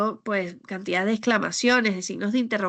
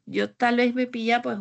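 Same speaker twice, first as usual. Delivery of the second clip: ordinary talk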